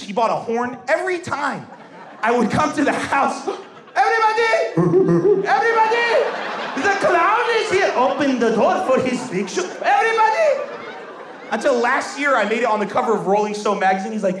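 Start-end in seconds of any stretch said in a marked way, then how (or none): 3.9-10.7 hammed-up herald of the clown; mock announcement. Dad announcing his son to the house, hammed-up herald.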